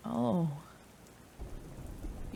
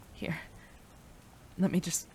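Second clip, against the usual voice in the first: ordinary talk, nothing marked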